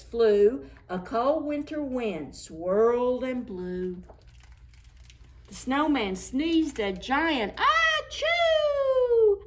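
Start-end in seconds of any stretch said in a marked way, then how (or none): 5.5-9.4 high, loud, slowed achoo sneeze; big sneeze. A narrator's reading. Narrator acts the snowman's giant sneeze, loud.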